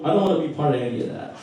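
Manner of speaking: normal talking voice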